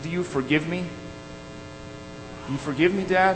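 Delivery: lightly acted contrite child; tentative, halting plea for forgiveness; retold tearful plea. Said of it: "stammering through his tears".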